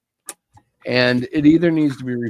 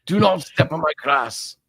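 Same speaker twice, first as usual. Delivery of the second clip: hammed-up, vague European accent on a warning; 4 semitones higher than usual. Old man warning children off his lawn, mock European accent. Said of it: "that is my European accent".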